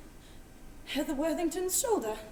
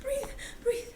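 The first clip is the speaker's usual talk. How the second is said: calming herself; 10 semitones higher than usual. Acted. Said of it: "breathe, [name], breathe".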